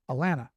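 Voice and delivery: "Atlanta" with both t's dropped, uh-LAN-uh; local Southern pronunciation. Shown as a demonstration. Trader telling of a vet trip, "Atlanta" as local Alanna. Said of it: "Notice that I didn't say Atlanta".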